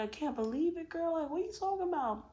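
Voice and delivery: high-pitched giddy disbelief; excited disbelief. Retold or imitated. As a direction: squeal it like big news. Retold excited disbelief to mom.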